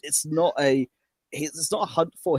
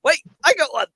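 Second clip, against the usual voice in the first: high excited squeak of discovery, a little louder; excited yelp; 11 semitones higher, 3 dB louder than usual. Retold or imitated. Fan voicing the cartoon critter as it grabs her, high and thrilled. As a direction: blurt it out like a thrilled kid. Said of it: "It's like".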